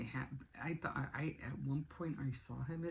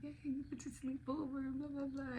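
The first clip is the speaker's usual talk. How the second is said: put-on kids cheering; 12 semitones higher than usual. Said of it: "they were like".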